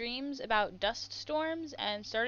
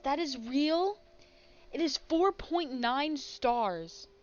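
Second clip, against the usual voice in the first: ordinary talk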